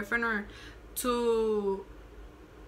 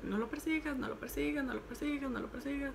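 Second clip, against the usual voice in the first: soft, higher repeated self-reminder; hushed self-urging; 3 semitones higher, 8 dB quieter, faster than usual. Retold or imitated. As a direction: repeat it under your breath like a retold inner mantra. Urging herself not to chase him.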